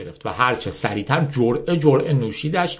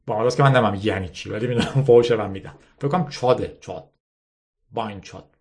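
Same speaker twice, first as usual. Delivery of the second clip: ordinary delivery, nothing marked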